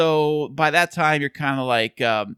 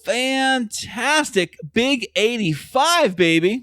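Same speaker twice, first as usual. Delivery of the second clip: hyped up; 9 semitones higher, much slower than usual. Acted. Hyped show opener.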